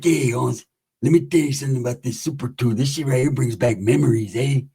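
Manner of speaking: lightly voiced low homie; understated low, reminiscing voice; released homie. Released homie reminiscing to the narrator about his Super Two, lightly voiced low.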